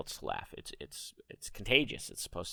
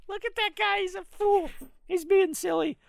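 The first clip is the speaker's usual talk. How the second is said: much higher, louder mocking voice with a laugh; mocking onlooker; 9 dB louder than usual. An onlooker mocking the silly laugher, high laughing jeer. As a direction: sneer at the fool like an amused bystander. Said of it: "somebody's gonna see that and be like".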